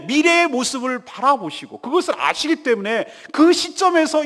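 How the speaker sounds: loud, driving sermon delivery; impassioned preaching. Real, not acted. Preacher assuring congregation God waits for them, impassioned. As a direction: build insistently, punching key words at full force.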